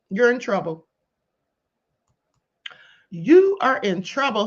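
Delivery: ordinary talk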